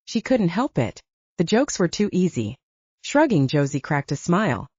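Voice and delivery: ordinary delivery, nothing marked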